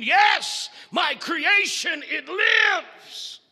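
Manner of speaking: high, loud ecstatic cry; excited shout. Acted. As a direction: scream it in wild joy. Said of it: "he shouts again".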